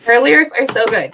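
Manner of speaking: firm, emphatic defence; emphatic fan's rebuttal; insistent praise. Real, not acted. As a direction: insist on it, standing up for the singer. Fan insists against the mocking echo.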